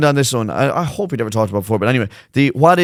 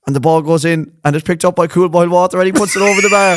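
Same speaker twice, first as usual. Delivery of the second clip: higher, excited Gaelic football commentary; sports commentator; 6 semitones higher, 3 dB louder than usual. Acted. Imagining a footballer on the ball, mock match commentary. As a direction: call the play like an excited commentator. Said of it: "think of Micheál Murray Hurtic calling out his name".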